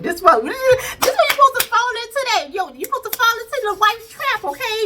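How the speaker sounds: high-pitched mock exasperation; exasperated scolding. Viewer telling off the clueless husband, exasperated. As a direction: scold him in a squeaky high voice.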